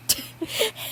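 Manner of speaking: a short airy high giggle; a real giggle that slips out mid-read; giggling at the story; breathless giggle. Real, not acted. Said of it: "all of my reactions were genuine".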